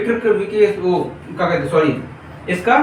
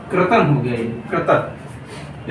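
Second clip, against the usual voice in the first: laughing at a slip; 5 semitones lower, 4 dB quieter, slower than usual. Real, not acted. Laughs at own stumble.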